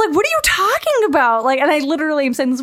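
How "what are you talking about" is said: high-pitched, incredulous question; flustered mock disbelief; bewildered retold protest. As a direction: imitate yourself baffled, pitched way up. Acts out bewildered reply to admirer.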